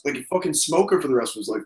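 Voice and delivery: ordinary talk, nothing marked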